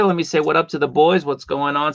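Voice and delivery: ordinary talk, nothing marked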